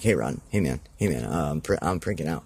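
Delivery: a wrestler fretting backstage, lightly voiced; understated backstage jitters before a match; imitating nervous Fanga. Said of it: "he'd be, like, freaking out".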